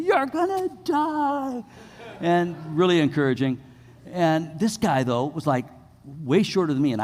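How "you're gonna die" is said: high, slow, drawn-out taunt from the stands; mocking friends yelling. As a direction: jeer it out long and high like mocking teens. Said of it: "start laughing, and they're going".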